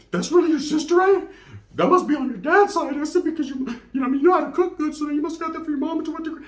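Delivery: high put-on voice, laughing, speeding up at the end; hammed-up teasing voice. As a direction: tease her in a silly high voice. Teasing sister, retold.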